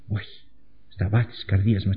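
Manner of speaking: ordinary talk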